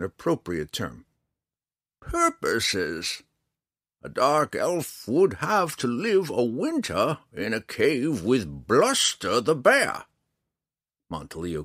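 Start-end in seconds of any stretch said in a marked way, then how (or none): 2.0-10.0 high, slow, louder character voice; old man, laboring with language. Acted. Old man struggling to converse with the drow, slow and high. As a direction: spell it out slowly in a raised pitch.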